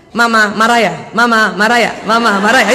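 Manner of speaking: "Mama marah ya" fired three times in a row; no pauses between. Shown as a demonstration. Husband wrongly asking "Mama marah ya" three times straight, hurried. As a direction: repeat "Mama marah ya" back to back, leaving no gap. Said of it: "Jangan langsung tiga-tiganya".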